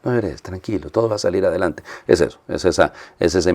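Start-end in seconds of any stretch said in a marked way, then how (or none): 0.0-1.8 very soft, soothing reassurance; hushed, as if holding someone crying; comforting a loved one. Acted. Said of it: "cuando usted abraza a una persona que quiere".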